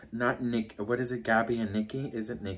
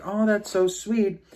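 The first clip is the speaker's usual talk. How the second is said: sweet retold reply; 10 semitones higher, 4 dB louder, slower than usual. Voices the sister's sweet reply.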